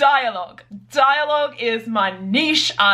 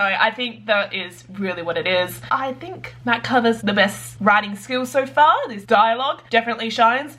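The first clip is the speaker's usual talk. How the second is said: ordinary delivery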